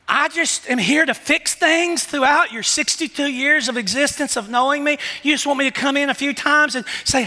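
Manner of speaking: lightly voiced God questioning his people; the Lord gently chiding those who only want fixes. Preacher voicing the Lord.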